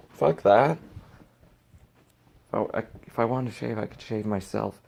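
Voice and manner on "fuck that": angry dismissal. Real, not acted.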